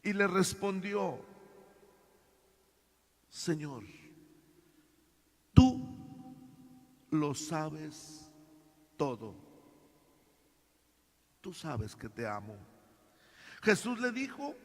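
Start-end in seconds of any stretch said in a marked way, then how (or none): 3.3-12.6 sad, broken reply. Acted. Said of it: "Pedro se entristeció".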